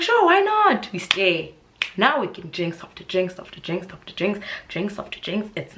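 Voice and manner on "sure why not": much higher, loud tipsy yell; excited shout. Retold or imitated. Agreeing to stay at the party, loud excited shout. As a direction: belt it out, game for anything.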